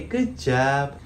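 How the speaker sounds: ordinary talk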